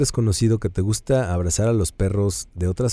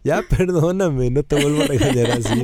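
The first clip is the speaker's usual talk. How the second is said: laughing plea to the dog; 6 semitones higher than usual. Retold or imitated. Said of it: "y es de".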